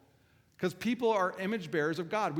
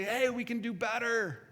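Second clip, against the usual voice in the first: high, drawn-out exhortation; rallying encouragement; 8 semitones higher than usual. Retold or imitated. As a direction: urge them on, pitched up and slower. Urging his kids to improve.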